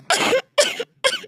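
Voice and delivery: a strained, high cough that won't stop; harsh coughing. Acted. Boss coughs harder while his assistant offers water. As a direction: cough as if choking on it.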